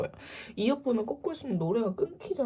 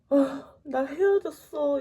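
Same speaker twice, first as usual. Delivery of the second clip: high, drawn-out mock wail; tearful confession; 7 semitones higher, 3 dB louder, much slower than usual. Sister confessing her breakup, imitated tearful wail. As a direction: whine it slowly as if sobbing. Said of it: "이렇게 울면서".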